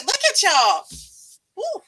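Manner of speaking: normal talking voice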